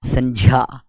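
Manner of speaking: "संज्ञा" said as "sanjya"; correct pronunciation. Shown as a demonstration. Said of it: "क्या है ये दोस्तों?".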